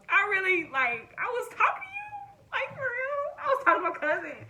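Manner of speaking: reliving the horror of almost kissing a cousin; high squealing dismay; horrified disbelief. Retold or imitated. Retelling her horrified thought.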